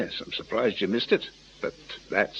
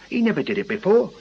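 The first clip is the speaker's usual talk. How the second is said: agitated; 9 semitones higher, 6 dB louder, faster than usual. Acted. Agitated man protesting to police.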